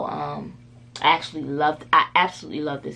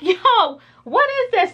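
much higher, louder shocked cry; shocked kid; 7 dB louder, slower than usual. Retold or imitated. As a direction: blurt it out like a stunned sixth grader. Sixth grader shocked by movie.

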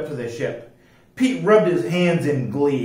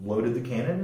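ordinary delivery, nothing marked

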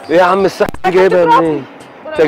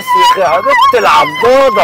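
pained yell. Acted. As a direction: cry out as if just bitten. Acted wife bites him, he yells. (صرخة وجع ممطوطة)

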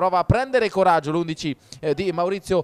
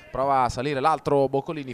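ordinary talk

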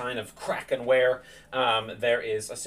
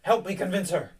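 higher, lightly exasperated appeal; Ismark, appealing; 7 semitones higher than usual. Acted. Ismark asking the party to help convince Irina, urgent appeal. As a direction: turn to the group for backup.

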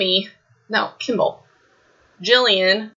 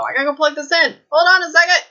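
rising higher and louder in a hurry; urgent call-out; 8 semitones higher, 4 dB louder, faster than usual. Real, not acted. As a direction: call out as you rush off. Battery dying, dashes off calling out.

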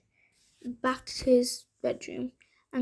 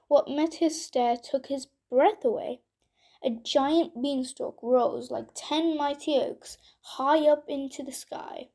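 ordinary delivery

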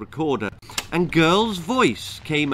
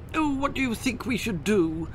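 high eager fairy voice; breathless and excited, pitched high; Tooth Fairy voice; 9 semitones higher than usual. Acted.

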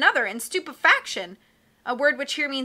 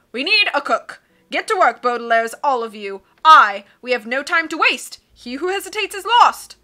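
loud, hearty captain voice; blustery sea captain; 9 dB louder than usual. Acted. Captain puzzling over charts, loud and hearty. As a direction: boom like a cheerful old sea dog.

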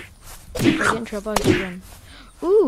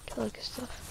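whispering; 15 dB quieter than usual. Real, not acted. Trailing aside while playing.

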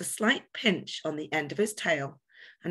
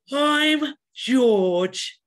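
much higher, louder yawned reply; yawning; 8 semitones higher, 9 dB louder than usual. A narrator's reading. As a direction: say it mid-yawn. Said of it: "George yawned".

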